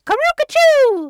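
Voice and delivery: high, loud drawn-out call; whooping, stretched-out cry; silly bird call. Retold or imitated. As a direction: holler it like a goofy mating call. Acting out a silly animal call.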